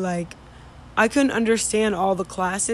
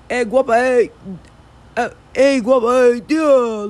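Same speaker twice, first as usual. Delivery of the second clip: "guapa" and "tía" run together into one big word, higher and louder; slurred southern catcall; 3 semitones higher, 7 dB louder than usual. Retold or imitated. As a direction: cut out the sounds so it flows as one word. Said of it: "they cut out almost all the words".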